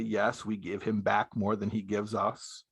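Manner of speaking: ordinary talk, nothing marked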